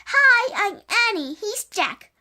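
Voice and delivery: high, loud yelled greeting; yelling. A narrator's reading. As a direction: call out to the octopus, high and loud. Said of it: "He yelled to the octopus".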